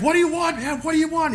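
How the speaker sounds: high-pitched panicked-sounding mock scream. Cornered suspect to his captor, high mock scream. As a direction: cry out shrilly without full volume. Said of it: "He's, like, screaming".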